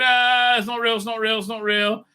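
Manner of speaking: high-pitched frightened chant, hammed up; mock panic. Retelling Velma's scary visions, mock panic. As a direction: imitate someone panicking at a vision, repeating it. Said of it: "she gets these scary visions".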